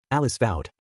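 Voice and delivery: plain reading voice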